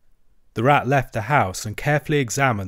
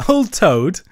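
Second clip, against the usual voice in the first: hearty laugh; 8 semitones higher, 8 dB louder than usual. A narrator's reading. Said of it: "laughing heartily".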